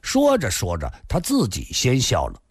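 ordinary delivery

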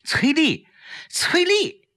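high-pitched, urgent cries of a name; calling out urgently. A narrator's reading. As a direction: call to rouse an unconscious friend. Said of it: "大声叫着她的名字". (急切地高声呼唤)

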